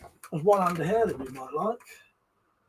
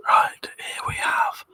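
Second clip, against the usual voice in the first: soft breathy whisper into the mic; hushed ASMR whisper for the stream chat; 5 dB quieter than usual. Pretend. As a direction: whisper like you're lulling viewers to sleep. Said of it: "Would you like some A S M R?".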